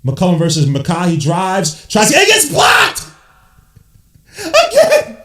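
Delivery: excited call jumping to a high shout on the block; hyped basketball commentary; live play-by-play. Real, not acted. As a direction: call it live and rattle on into the timeout.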